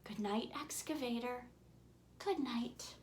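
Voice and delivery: ordinary delivery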